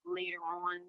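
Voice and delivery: usual speaking voice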